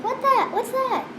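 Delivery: high, puzzled startled voice; like hearing a strange noise; startled what's that. Retold or imitated. Imitating startled person at cricket.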